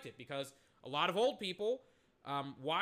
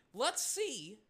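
voice cracking; 5 semitones higher than usual. Real, not acted. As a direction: voice cracks on the words. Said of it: "My voice just cracked".

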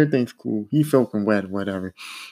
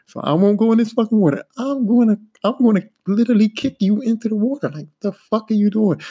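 angry threat; 6 semitones higher than usual. Retold or imitated. To careless coworker risking his fall, heated angry threat. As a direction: build it into a heated threat to kick him in.

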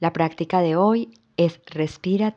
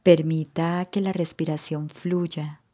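calm yoga nidra guidance with long pauses; guided relaxation. Acted. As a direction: guide the listener into rest, phrase by unhurried phrase. (relajación guiada, con calma y pausas largas)

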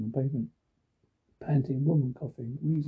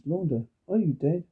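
ordinary delivery

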